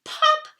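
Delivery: very high loud popping sound; cork pop. Acted. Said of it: "just as if a cork".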